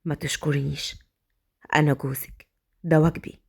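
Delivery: low, soft, close to her ear; husband murmuring close. Acted. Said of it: "قرب من ودانها وقال". (همس قريب من الأذن بصوت منخفض)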